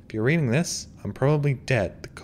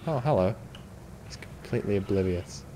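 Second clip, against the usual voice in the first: ordinary talk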